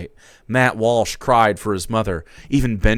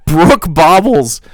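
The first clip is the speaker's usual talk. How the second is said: ordinary delivery, nothing marked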